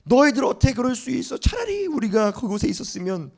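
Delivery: high, indignant accusing outcry; angry complaint. Acted. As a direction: blame the leaders to their face. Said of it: "막 따지는 거예요". (백성이 모세와 아론에게 높은 목소리로 따지듯)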